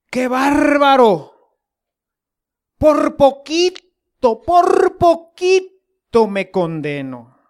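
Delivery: slow, drawn-out, amazed; relieved astonishment. Retold or imitated. A preacher marveling he nearly was damned, slow and amazed. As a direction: stretch out every word in disbelief. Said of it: "en una predicación decía".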